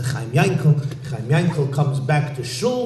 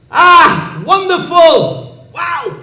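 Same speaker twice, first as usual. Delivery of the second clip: high, loud, thrilled exclamation; a ruler overjoyed at the idea; delighted overlord; 12 semitones higher, 8 dB louder, slower than usual. Acted. Overlord delighted at Chaim Yankel's offer, high excited voice.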